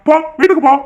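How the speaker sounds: high-pitched, sharp command; angry order. Acted. Brother ordering his sister home, angry. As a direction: send her off in a temper.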